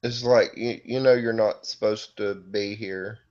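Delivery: normal talking voice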